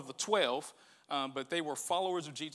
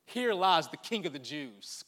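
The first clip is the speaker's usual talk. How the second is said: scoffing; 2 semitones lower, slower than usual. Retold or imitated.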